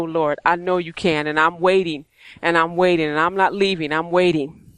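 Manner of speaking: low, subdued, steady pleading; earnest prayer. Retold or imitated. Parent praying to the Lord for children, earnest prayer. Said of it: "crying out for my children".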